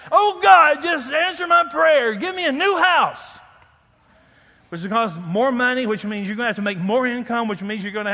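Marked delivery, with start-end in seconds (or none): 0.1-3.2 loud, high hammed-up prayer shout; mock shouting at God for a new house. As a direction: yell the prayer like a greedy believer. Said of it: "Anybody ever prayed that?".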